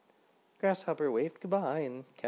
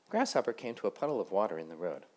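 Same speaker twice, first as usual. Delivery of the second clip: usual speaking voice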